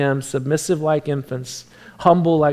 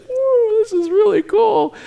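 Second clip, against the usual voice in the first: put-on much higher, wide-eyed awe of a small child; high, amazed little-girl voice; amazed toddler; slower than usual. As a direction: imitate a two-year-old gasping in wonder. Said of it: "She's, like, amazed".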